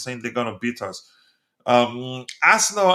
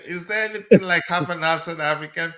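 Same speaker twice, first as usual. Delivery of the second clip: higher, laughing retelling of the question; amused disbelief; 4 semitones higher than usual. Real, not acted. Host rereading viewer's claim to the stream, amused. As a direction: repeat it still chuckling.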